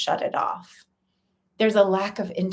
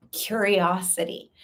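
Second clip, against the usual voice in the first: normal talking voice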